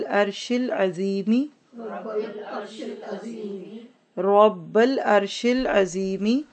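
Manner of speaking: very slow, drawn-out Arabic dua; slow repeat-after-me recitation. Shown as a demonstration. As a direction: stretch each phrase for learners to repeat. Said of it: "आप मेरे साथ दोहरा लीजिए".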